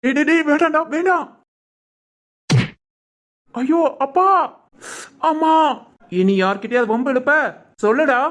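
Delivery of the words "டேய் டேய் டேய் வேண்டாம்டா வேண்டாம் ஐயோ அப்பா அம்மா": high, frantic pleas to stop; panicked begging. Acted. Bully begs the hero to stop, panicked and high. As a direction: beg him to stop hurting you.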